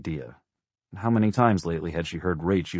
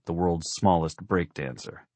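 plain reading voice

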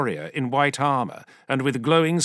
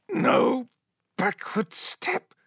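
hoarse shout; 12 semitones higher, much slower than usual. A narrator's reading. Shouted motto in the race.

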